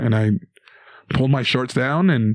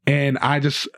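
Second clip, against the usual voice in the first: normal talking voice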